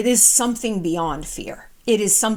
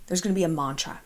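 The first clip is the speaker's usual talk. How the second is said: ordinary talk, nothing marked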